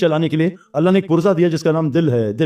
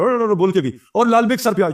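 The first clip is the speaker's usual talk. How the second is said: normal talking voice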